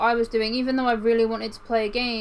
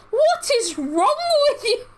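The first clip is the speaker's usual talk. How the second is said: much higher and louder, mock exasperation; hammed-up boyfriend losing it; exasperated high outburst; 14 semitones higher, 5 dB louder than usual.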